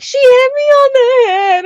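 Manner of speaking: high, loud mock wail; whiny crying kid. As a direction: tattle in a hammed-up crying voice. Voices crying brother tattling.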